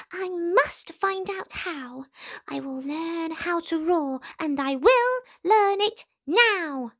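a tiny mouse steeling himself; determined mouse voice. A narrator's reading. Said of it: "thought the mouse".

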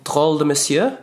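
brand name in an attempted French accent. Real, not acted. Vlogger naming a French clothing brand, attempted French accent. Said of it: "Pardon the French accent".

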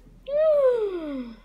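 high contented sigh. Acted. Waking after a sleep.

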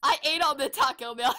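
usual speaking voice

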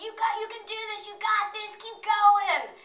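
mock yell of encouragement, pitched high; retold scream. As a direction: imitate a belayer screaming up encouragement. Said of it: "a belayer below me screaming".

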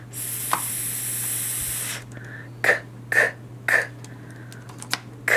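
"S" and "k" hissed unvoiced; sounded out in whisper. Shown as a demonstration. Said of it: "whispered consonant sounds".

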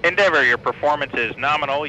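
crackly radio transmission; thin voice over a radio link; mission control radio. Real, not acted. Capcom radio call to crew.

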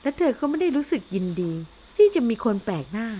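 usual speaking voice

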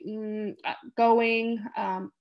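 slow, halting words with long hesitations between them; imitating a patient searching for words; halting broken speech. Shown as a demonstration. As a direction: struggle to push each word out. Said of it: "they kind of break it up".

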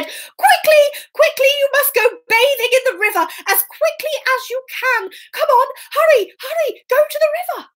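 high-pitched, insistent hurrying; urgent Puss. Acted. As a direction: chivvy them along in a high voice. Puss hurries the miller's son.

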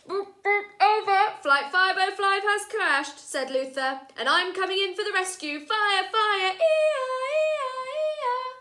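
high, loud radio chatter and sung siren; playful pilot. Acted. Luther playing crash rescue, high, loud and playful. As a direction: play at rescue with a wailing siren.

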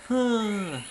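ordinary delivery

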